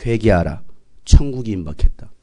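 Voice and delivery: low, solemn proclamation; John the Baptist. Acted. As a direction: announce a stern warning in a low voice. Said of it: "침례 요한 그렇게 말하지 않았어요".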